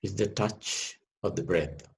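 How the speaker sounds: ordinary delivery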